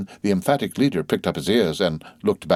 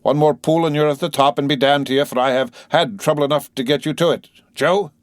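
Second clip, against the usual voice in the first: 1 urging the horses; 7 semitones higher, 4 dB louder than usual. A narrator's reading. Coachman urging horses uphill.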